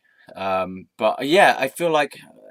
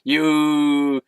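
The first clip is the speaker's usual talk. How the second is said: slow oafish farmer; 4 semitones higher, 5 dB louder, much slower than usual. Retold or imitated.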